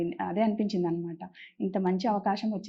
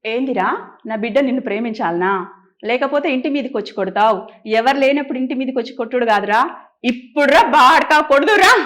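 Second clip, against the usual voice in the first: channel her mom in village slang, growing louder; rough village mother; 8 dB louder than usual. Acted. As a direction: Telangana village mom threatening the hero, ending loud and laughing. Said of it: "మీ మమ్మీని గుర్తు చేసుకోండి".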